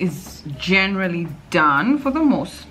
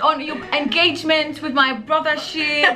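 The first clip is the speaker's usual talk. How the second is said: high, gushing and laughing; hammed-up overexcited catch-up; pretend abroad friend; 8 semitones higher than usual. Friend abroad gushing over a missed engagement. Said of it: "I'm like".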